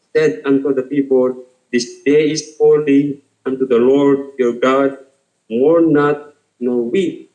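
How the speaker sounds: slow, measured Bible reading; scripture reading. Acted. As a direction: read the verse deliberately, letting each phrase land. Reads scripture aloud in English.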